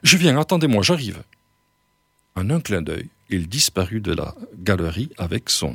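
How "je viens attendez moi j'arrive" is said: high, loud, eager cry, easing off; calling out. A narrator's reading. Old man runs after his host, calling out. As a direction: call after them to wait. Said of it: "tout en criant".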